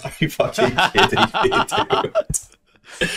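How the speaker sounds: high, incredulous, cracking up; laughing disbelief. Real, not acted. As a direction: protest through helpless laughter.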